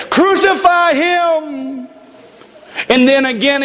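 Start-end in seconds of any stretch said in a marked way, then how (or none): 0.1-1.9 high, drawn-out crowd call; crowd's cry. Acted. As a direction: imitate the mob, high and long. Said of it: "hear the crowd again when it said".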